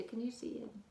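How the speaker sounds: ordinary delivery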